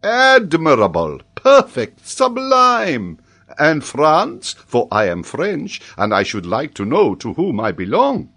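loud, high exclamations of praise; theatrical professor, extravagantly amazed; excitable Frenchman. Acted. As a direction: exclaim grandly, then press the question. Paganel's mock praise and question.

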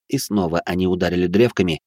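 normal talking voice

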